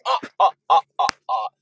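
hammed-up Transylvanian announcer; Count Dracula. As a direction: count it out like a cartoon vampire, laughing ah-ah-ah. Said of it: "I feel like Count Dracula".

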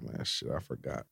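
very soft, low mumble; mumbled. Retold or imitated. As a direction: barely mumble it out. Too-chill landlord mumbling.

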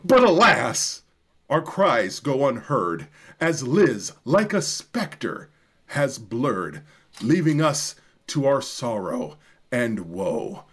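hammed-up tragic recital, climbing to wailing highs; dramatic poem reading. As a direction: declaim it like a grieving gothic bard. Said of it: "your radio announcer voice or your Barry".

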